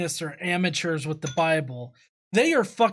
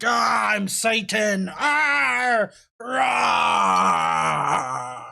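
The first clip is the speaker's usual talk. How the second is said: mock monster Satan; much slower than usual. Mocking the preacher's Satan as a roaring Godzilla, hammed-up roar. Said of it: "He's like".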